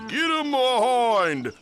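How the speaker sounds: high, loud drawn-out yell; long falling shout. A narrator's reading. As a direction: holler it high, letting the pitch fall away. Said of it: "and they yell".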